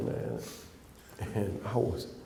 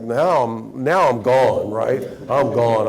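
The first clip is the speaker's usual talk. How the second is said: fired-up exclaiming; 8 dB louder, slower than usual. Retold or imitated. Retelling getting fired up.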